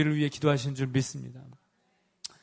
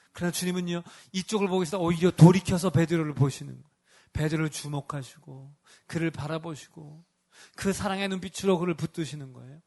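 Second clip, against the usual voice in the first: normal talking voice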